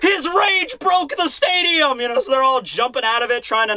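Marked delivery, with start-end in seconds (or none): 0.0-2.0 high, drawn-out mock alarm; panicked exclamation. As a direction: cry out like a crowd in hammed-up panic. Everyone reacts in alarm.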